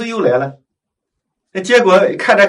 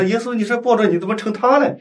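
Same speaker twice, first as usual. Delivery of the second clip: higher-pitched mock indignant protest; retold annoyance; 7 semitones higher than usual. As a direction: complain to Jesus in mild, retold exasperation. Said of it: "他就生气". (模仿生气地质问，音调偏高)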